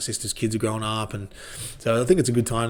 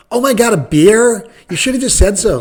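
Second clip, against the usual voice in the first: mock barmaid; 11 semitones higher, 11 dB louder than usual. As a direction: ham up the relieved American barmaid.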